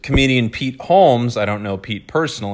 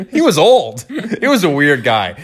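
higher, louder words broken by real laughter; laughing while talking; 8 semitones higher, 4 dB louder than usual. Real, not acted. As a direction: giggle through the description of the boss. Laughing while describing weird boss.

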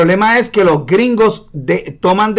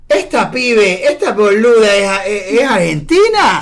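mock Argentine accent, higher; exaggerated Rioplatense "che" voice, playful; 4 semitones higher than usual. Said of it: "Y yo decía". (acento argentino exagerado, en broma)

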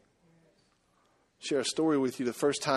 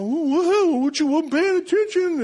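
much higher, louder mock woman's squawk; upset lady driver; 13 semitones higher, 4 dB louder, slower than usual. Angry driver fussing at the men who bumped her car, mock high-pitched fussing.

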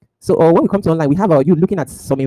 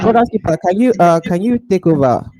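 higher, louder call for attention; 5 semitones higher, 4 dB louder, slower than usual. Real, not acted. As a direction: call out to get his attention. Urgently handing over the call.

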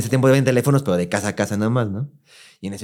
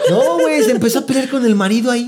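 laughing; 13 semitones higher, 3 dB louder than usual. Real, not acted. Laughing while telling the story.

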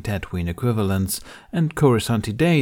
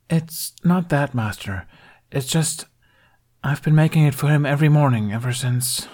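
higher, halting, hesitant explanation; hesitant, trailing off; 6 semitones higher than usual. A narrator's reading. Apprentice explaining himself to a master, hesitant, trailing off. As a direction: start haltingly, then leave it hanging. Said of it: "He trailed off with a sigh".

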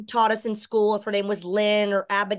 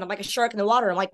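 ordinary delivery

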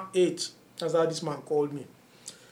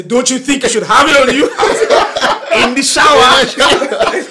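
loud, high, exasperated imitation of dad; hammed-up fatherly outburst, drawing out the end; mock scolding; 7 semitones higher, 13 dB louder than usual. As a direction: berate your son in comic disbelief. Father scolding son in retold quarrel.

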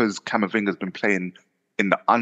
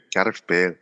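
put-on light Welsh accent on the name; a touch of Welsh lilt. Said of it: "Was that your Welsh accent?".

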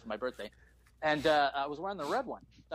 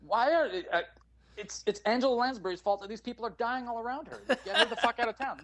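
indignant rant; 7 semitones higher, 4 dB louder than usual. Retold or imitated. Outraged child complaint.

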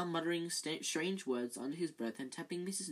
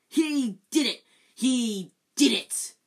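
high, very loud, slowing furious accusation; spat out; 8 semitones higher, 12 dB louder, slower than usual. Acted. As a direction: spit it at the boy in rage. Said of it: "Filch spat".

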